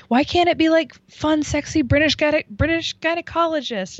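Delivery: a high, complaining mock of the host's speech; high mock whine. Mimicking the host on stage, high and whining. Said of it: "And then she's like".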